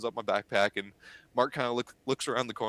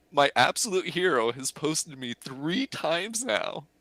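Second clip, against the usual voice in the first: ordinary talk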